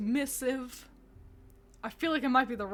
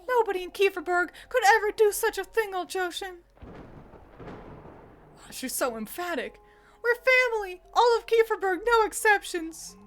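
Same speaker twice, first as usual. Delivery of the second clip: much higher, louder heartfelt plea; rally the village as family; impassioned Freya; 9 semitones higher, 5 dB louder than usual. Acted.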